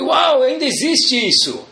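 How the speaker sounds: high, surprised exclamation; amazed. Retold or imitated.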